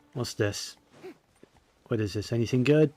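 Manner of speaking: usual speaking voice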